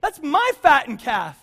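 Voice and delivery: hammed-up outrage, voice leaping up in pitch; indignant older brother. Older brother objecting over his fattened calf, acted indignation. He's not mad.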